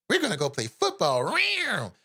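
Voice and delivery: mocking little mascot voice, ending in a high rawr; harmless tiger mascot. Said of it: "what the little Bengal mascot looks like".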